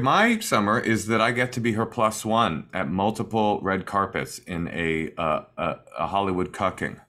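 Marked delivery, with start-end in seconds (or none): none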